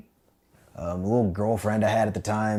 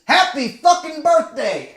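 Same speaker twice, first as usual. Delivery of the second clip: much higher, louder slow yell; mock yell; 6 dB louder, slower than usual. Sergeant yelling at the recruit in the locker, furious. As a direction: roar it as a cruel birthday wish. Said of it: "he says".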